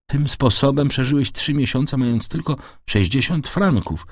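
ordinary delivery, nothing marked

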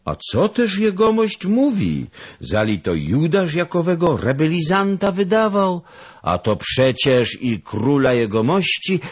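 high, loud astonished servant; indignant protest. Acted. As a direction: protest your innocence. (Rzędzian oburzony)

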